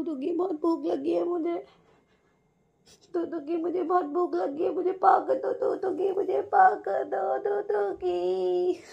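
high, slow stammering dog voice; voicing the dog. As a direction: speak for the hungry pet, pleading. Voicing the pet dog's thoughts. (कुत्ते की ऊँची मिन्नत भरी आवाज़)

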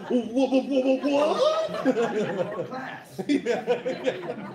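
loud, high, laughing "what". Real, not acted. Teacher reacting to students' laughter, laughing loudly. Cracking up at the class.

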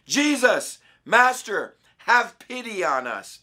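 high, loud plea, drawn out at the end; calling out loudly. A narrator's reading. Ten lepers call to Jesus from a distance, calling out loudly. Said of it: "they called out in a loud voice".

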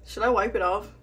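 ordinary delivery

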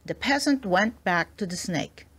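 usual speaking voice

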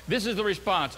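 normal talking voice

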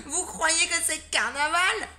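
high, loud and slow, laughing through it; a boy jeering between laughs; mocking. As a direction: tease her while still laughing. Nathan mocks the sweater.

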